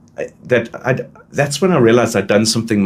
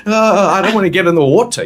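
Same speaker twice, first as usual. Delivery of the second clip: put-on whiny reluctant boy; 9 semitones higher, 3 dB louder than usual. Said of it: "you're like".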